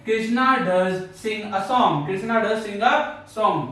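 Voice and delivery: very slow, Krishna does sing a song word by word; slow dictation. Shown as a demonstration. Teacher dictating an example to the class slowly. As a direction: dictate it so students can write. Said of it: "तो बोलेंगे".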